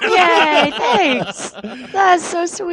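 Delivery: high, bright thrilled thanks; excited squeal. Real, not acted. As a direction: gush with delight. Guest greeted with applause.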